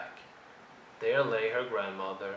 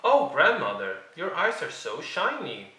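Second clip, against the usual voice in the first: wondering exclaim; 5 semitones higher, 6 dB louder than usual. A narrator's reading. Girl exclaims to disguised wolf.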